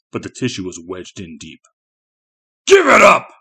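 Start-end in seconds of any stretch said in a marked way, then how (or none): 2.7-3.3 high, loud monster roar; explosive creature bellow. A narrator's reading. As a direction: roar the order at a captive. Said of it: "his captor roared at him".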